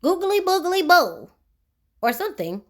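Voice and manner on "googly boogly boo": high, slow goblin nonsense; goblin gibberish. Acted. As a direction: croon it like a strange little goblin. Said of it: "said something which sounded like".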